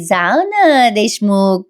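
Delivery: लाडीक, लांबवलेली विनवणी; लाडाने. Acted. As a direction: coax him sweetly, drawing the words out slowly. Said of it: "मग काव्या लाडाने म्हणते". (लाडाने, शब्द ताणत)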